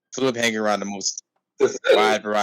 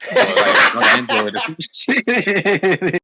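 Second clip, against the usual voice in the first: laughing; 10 semitones higher, 4 dB louder, much slower than usual. Real, not acted. Laughing while mocking friend.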